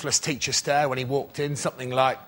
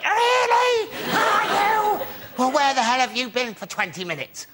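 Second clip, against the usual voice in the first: mock furious shout; 11 semitones higher than usual. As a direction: explode at the late kid. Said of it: "I went".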